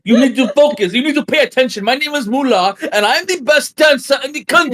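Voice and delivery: high, loud strict dance teacher; hammed-up stern instructor bragging; ballet teacher voice. Voicing the ballet teacher Mullah.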